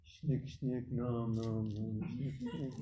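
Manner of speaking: soft mock mumble "shnik, shnik, nom, nom"; mumbled fast chant. Shown as a demonstration. Mocking a movement of rushed chanters, soft mumble. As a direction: mutter garbled syllables quickly. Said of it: "you have a movement of".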